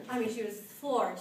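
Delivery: ordinary talk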